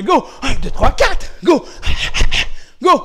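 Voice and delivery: loud, high karate count; punchy instructor's shout; shouted drill commands. Real, not acted. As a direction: call out each punch command with force.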